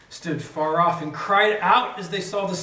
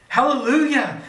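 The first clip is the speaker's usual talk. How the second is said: higher, louder ringing Hallelujah; cried hallelujah; 8 semitones higher, 7 dB louder than usual. Acted. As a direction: lift it like a crowd's shout of praise. Multitude crying out hallelujah.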